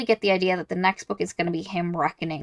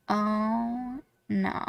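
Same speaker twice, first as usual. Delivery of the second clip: softer, dropping "Oh, no" of sinking dread; a reader's whispered-feeling realization, much softer; hushed dread; 10 dB quieter than usual. Retold or imitated. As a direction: let it sink in, barely aloud.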